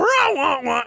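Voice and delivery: high, discordant vocal violin; imitated bad violin playing. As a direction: mimic a horribly played violin. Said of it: "he cannot play it".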